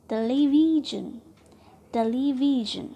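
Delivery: Television loud, with VI stressed; second-last syllable stressed. Shown as a demonstration. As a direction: swell onto 'वि' in television. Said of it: "syllable पर trust कर रहे हैं". (Television में 'वि' पर ज़ोर)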